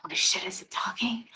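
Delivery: breathless unvoiced whisper. Player whispering in shock that the werewolf talks. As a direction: whisper it, awestruck.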